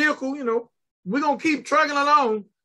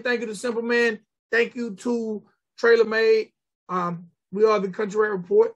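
normal talking voice

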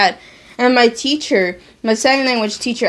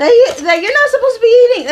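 angry mom; 13 semitones higher, 6 dB louder, faster than usual. Retold or imitated. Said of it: "my mom got angry at me".

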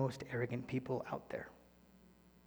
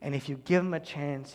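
ordinary talk, nothing marked

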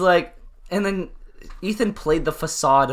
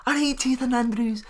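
mock Irish accent; 8 semitones higher, 6 dB quieter than usual. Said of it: "She's like".

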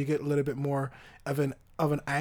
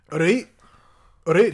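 rough attempt at a Scottish accent; loud mock-Scottish burst; 3 semitones higher, 8 dB louder than usual. Shown as a demonstration. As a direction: punch it out in amateur Scots. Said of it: "the only Scottish accent I can do".

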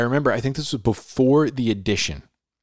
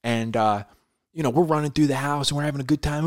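ordinary talk, nothing marked

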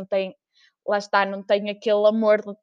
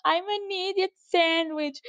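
slow, higher, meek mock confession; idiot sandwich contestant; 8 semitones higher, 4 dB quieter, much slower than usual. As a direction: say it slowly like a humiliated contestant. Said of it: "e ela teve que dizer". (confissão lenta e envergonhada, mais aguda)

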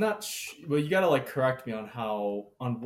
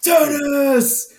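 put-on much higher, louder, yelling like a kid at tennis practice; mock cheering shout; 13 semitones higher, 11 dB louder than usual. Tennis kids shouting outside the dorm window, loud cheering. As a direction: whoop it like a teen who just won a point. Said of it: "kids are just going".

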